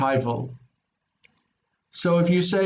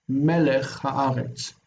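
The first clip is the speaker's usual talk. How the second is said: Melech haaretz slow, with the first syllable of Melech stressed; slow model phrase; 2 semitones lower, slower than usual. Shown as a demonstration. Teacher repeating the phrase for the student, slow and clear. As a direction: lean on Melech, then the accent on haaretz. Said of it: "keep its own secondary accent".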